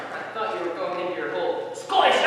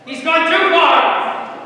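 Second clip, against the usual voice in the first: loud, higher, slow outraged cry; indignant shout; 8 semitones higher, 10 dB louder, much slower than usual. Acted. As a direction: declare it in outrage. Marlowe loses patience.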